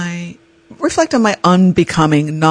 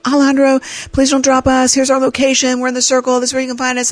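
urgent phone plea; 8 semitones higher, faster than usual. Retold or imitated. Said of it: "I'm like".

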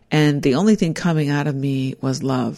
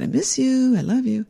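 much higher sing-song I miss you; 9 semitones higher than usual. Retold or imitated. Her ex-husband in hospital speaking sing-song. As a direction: lilt it up like he used to. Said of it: "just in a sing-song voice".

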